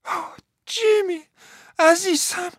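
high, drawn-out cry of his name. A narrator's reading. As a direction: call out to him in anguish. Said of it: "فریاد زد". (فریاد بلند و زیر)

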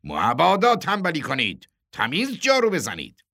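high, loud mock order; gleeful warning. A narrator's reading. Boss winks happily at narrator while ordering sweeping, gleeful. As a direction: playfully order them about. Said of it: "با خوشحالی به من چشمکی زد".

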